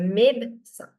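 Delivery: médecin with the middle E dropped; silent second E. Shown as a demonstration. Teacher giving correct médecin, second E dropped. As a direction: squeeze médecin into "mayd-san". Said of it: "But since it's not there".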